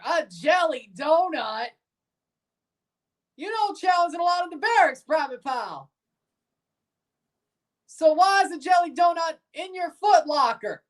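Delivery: high, mocking sergeant interrogation; drill sergeant. Drill sergeant questioning Private Pile about the donut, high scorn. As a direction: pick the excuse apart with scorn.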